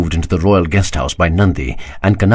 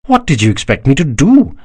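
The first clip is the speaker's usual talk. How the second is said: startled, pitch jumping up high; surprised cry; 6 semitones higher than usual. A narrator's reading. Daksha, caught out by his minister, cries out surprised. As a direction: caught off guard, defend yourself. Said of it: "cried a surprised Daksha".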